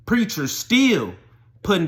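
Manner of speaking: high, loud, stretched-out words; emphatic refrain. Real, not acted. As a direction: hammer the refrain slowly and loudly. Repeated refrain, building.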